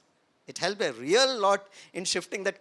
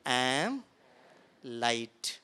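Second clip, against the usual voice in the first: gentle loving affirmation, lower voice; lovingly; 8 semitones lower, much slower than usual. Shown as a demonstration. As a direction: say it to yourself with love. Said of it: "थोड़ा प्यार मोहब्बत से बोलो".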